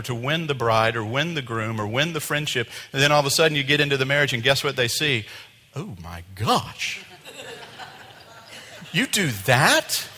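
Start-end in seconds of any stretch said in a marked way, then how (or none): 5.7-10.1 high, loud, laughing mock disbelief; hammed-up shocked husband or wife; shocked spouse. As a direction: play the stunned new spouse. Spouse shocked after the wedding.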